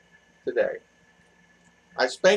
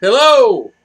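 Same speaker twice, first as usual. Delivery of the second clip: loud greeting; 6 semitones higher, 10 dB louder than usual. Real, not acted. Greeting live stream viewers loudly.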